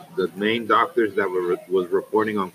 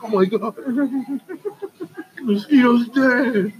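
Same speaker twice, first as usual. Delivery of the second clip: high, wailing mock mom; mom crying; 14 semitones higher, slower than usual. Son mimics mom crying over a killed mosquito, mock wailing. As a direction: drag it out in a high, tearful mock wail. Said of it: "She'll be like".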